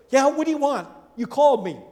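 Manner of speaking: put-on a boy woken and keen to serve; eager boy. Boy Samuel runs to Eli, eager and loud.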